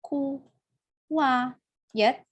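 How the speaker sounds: QUIET broken slowly into ku-wa-yet; syllable-by-syllable demo. Shown as a demonstration. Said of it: "Ini quiet".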